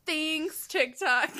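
high, loud mock thanks; sarcastic thank-you to the app; mock grateful.

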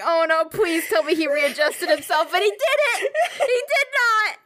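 high, laugh-crying; squealing through laughter. Real, not acted. Telling a friend he never readjusted, laugh-crying.

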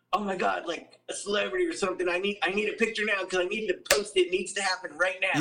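high, fast, breathless mock fan. Mocking fans who demand a photo, high and rushed. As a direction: rattle it off like a pushy fan. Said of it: "they're just so robotic that they think".

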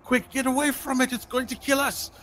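high frightened shout to the others; high panicked cry while fleeing; panicked yell. Acted. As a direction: warn them in terror. Fleeing NPC yells a warning.

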